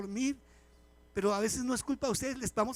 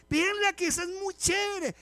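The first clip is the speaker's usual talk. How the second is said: son's excited recommendation; 10 semitones higher, 5 dB louder than usual. Retold or imitated. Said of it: "me dijo uno de mis hijos".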